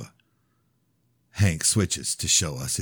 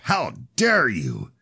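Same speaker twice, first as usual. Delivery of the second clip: slow, outraged father; indignant, drawn-out outrage; 7 semitones higher, slower than usual. Acted. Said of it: "Father shoots up".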